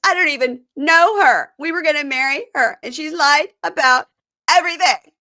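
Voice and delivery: a hammed-up, exasperated outburst; mock outrage. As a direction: vent her betrayal in a hammed-up way. [name] upset at the deception.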